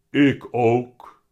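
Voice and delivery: heavy cat voice. A narrator's reading. Dikke Teun repeats himself to Willem, loud heavy voice. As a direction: repeat it firmly in a big cat's heavy voice. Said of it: "met zware stem".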